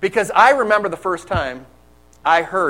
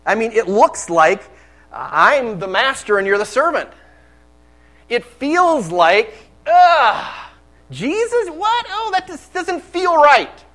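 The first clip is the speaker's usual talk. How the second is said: higher, anguished, slow voicing of Peter; Peter's inner thoughts; 7 semitones higher, 5 dB louder, slower than usual. Peter flustered as Jesus kneels, rising anguish. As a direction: let the disbelief climb to a pained cry. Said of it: "voice in probably what he was thinking".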